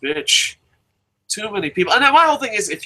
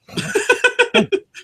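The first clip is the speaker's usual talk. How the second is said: high burst of laughter. Real, not acted. Laughs at his own story.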